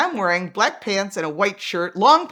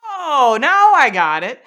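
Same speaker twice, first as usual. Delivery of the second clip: high, loud dawning oh; sudden exaggerated understanding; mock realization; 5 semitones higher, 9 dB louder, slower than usual. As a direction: act out the penny dropping. Sudden realization about the drinker.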